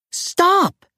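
very high-pitched character shout. A narrator's reading. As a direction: call out sharply, way up high. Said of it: "a voice shouted".

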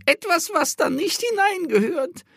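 high, croaking crone voice; croaky old woman. A narrator's reading. Old woman keeps complaining.

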